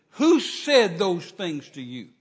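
loud, slow, indignant demand; retold anger. Man demanding who upset his fiancée, slow angry re-enactment. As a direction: re-enact anger, leaning slowly on each word. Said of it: "It just made me so angry".

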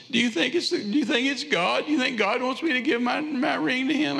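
high, pleading mock sob-voice; imitated crying. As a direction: squeak it out like a wife in tears. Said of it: "she just kept on just snubbing and crying".

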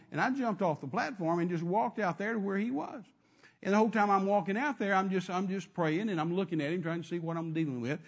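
ordinary talk

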